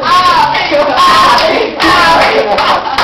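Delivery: loud, slow, high straining cry; straining shout. Retold or imitated. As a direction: cry out while straining to twist. Blonde struggling to open soda. (grito arrastado de esforço)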